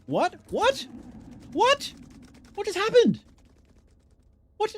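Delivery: real gamer shock as the turn disappears; shocked disbelief. Real, not acted. Streamer reacting to a lost turn, shocked disbelief.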